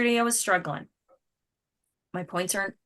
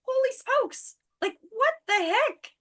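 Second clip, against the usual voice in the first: very high, incredulous outburst; shocked at the returned product; exasperated disbelief. Retold or imitated. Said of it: "It's like".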